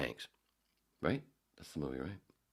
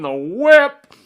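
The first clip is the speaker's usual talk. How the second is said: shouted show name; 21 dB louder than usual. Acted.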